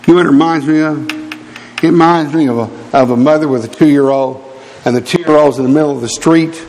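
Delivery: ordinary delivery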